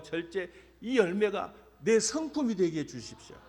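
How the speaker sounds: 점점 고조되는 아침 기도; morning prayer. Shown as a demonstration. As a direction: pray it line by line, rising at the end. Recites his morning prayer.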